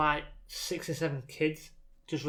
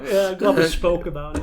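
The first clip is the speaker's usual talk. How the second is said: laughing; 4 semitones higher, 8 dB louder than usual. Real, not acted. Laughing at their own rambling.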